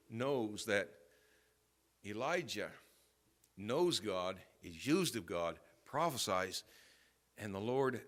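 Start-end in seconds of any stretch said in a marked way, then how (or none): none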